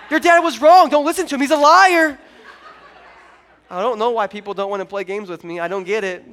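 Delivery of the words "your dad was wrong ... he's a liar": much higher and louder, playful outburst; hammed-up indignation at the other family; mock rant. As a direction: pastor mock-shouting about a family's game rules. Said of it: "I was like".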